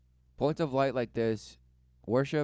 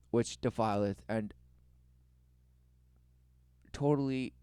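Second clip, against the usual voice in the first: hammed-up solemn preaching; mock sermon. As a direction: preach it like a grave prophet.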